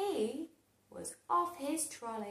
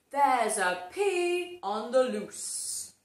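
grave warning; 7 dB louder than usual. Acted. Sue Potato's warning.

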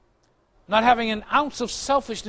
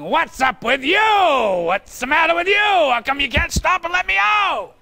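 louder, higher mock yelling at a driver; hammed-up Italian temper; wife yelling at a driver blocking her exit, mock rage; Italian-style road rage; 8 semitones higher, 5 dB louder, slower than usual. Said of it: "she does the Italian thing".

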